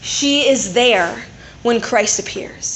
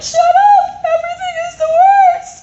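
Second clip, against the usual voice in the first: high, loud, whiny crying shout; whiny mock wail; 7 dB louder than usual. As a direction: wail it like a sulking teen in a meltdown. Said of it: "And you're like".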